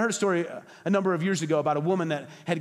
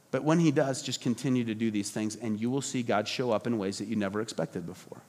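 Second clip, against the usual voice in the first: tender, softer; 6 semitones lower, 4 dB quieter than usual. Real, not acted. Tender turn about God's ways.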